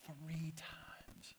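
drawn-out, voiceless whisper; hushed emphasis. Retold or imitated. Jesus foretells the denial to Peter, whispered. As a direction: whisper it slowly for weight. Repeats the prediction for effect.

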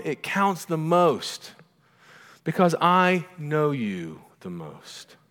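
slow, high, emphatic declaration; insistent mother. Retold or imitated. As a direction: land every word with motherly conviction. Mother insisting to her crying son.

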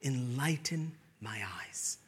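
slow, hushed plea; whispered prayer. Acted.

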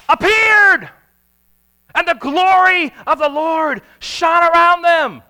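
loud, high, slow announcement; preacher booming the angel's appearance; dramatic proclamation. Acted. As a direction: proclaim it with awe and power.

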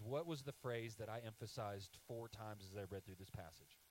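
ordinary talk

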